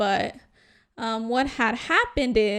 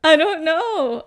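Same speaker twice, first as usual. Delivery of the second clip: laughing; 5 semitones higher, 9 dB louder, slower than usual. Real, not acted. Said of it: "Why are you laughing like that?".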